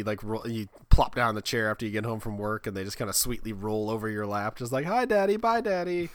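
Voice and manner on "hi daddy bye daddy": put-on high, sing-song little-kid voice; sweet child. Kids rolling over dad's lap, mock-sweet high child voice. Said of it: "They just come sweetly".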